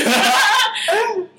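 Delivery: high, loud burst of laughter. Real, not acted. Amused at her own story, loud laugh. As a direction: let a big laugh out.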